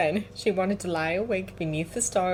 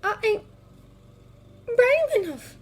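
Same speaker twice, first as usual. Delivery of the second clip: put-on young girl voice; 4 dB louder than usual. Lyra voiced.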